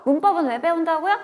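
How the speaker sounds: normal talking voice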